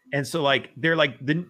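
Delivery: ordinary talk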